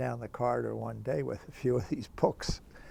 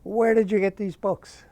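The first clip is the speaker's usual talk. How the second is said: higher, louder accusing question; stern demand; 8 semitones higher, 11 dB louder than usual. Retold or imitated. Dean interrogating the student over the books, loud and higher. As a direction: confront him about the books.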